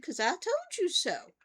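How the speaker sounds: high, emphatic comeback; told-you-so retort. Retold or imitated. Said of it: "And I said".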